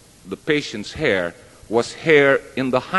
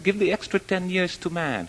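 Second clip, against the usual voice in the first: lightly acted-out refusal; mock objection; 10 semitones higher, 4 dB quieter than usual. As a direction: imitate an objector, pitched well up. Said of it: "Lion objected".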